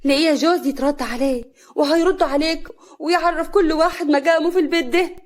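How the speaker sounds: loud, high, indignant retort; angry. A narrator's reading. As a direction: talk back hotly to the elders. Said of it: "هنا بصت لهم بغضب".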